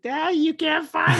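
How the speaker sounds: put-on high child voice; little daughter. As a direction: play the small girl hiding. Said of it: "she's like".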